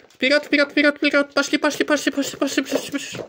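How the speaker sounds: high, loud, urging call to the dogs. Real, not acted. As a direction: chant it high to hurry the dogs. Herding dogs back to room.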